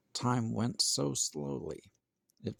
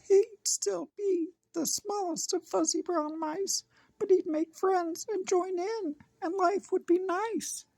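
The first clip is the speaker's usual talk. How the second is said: very high and louder squeaky little mouse; a small mouse dreaming big, squeaking; tiny mouse voice; 4 dB louder than usual. Acted. Mouse voice in story.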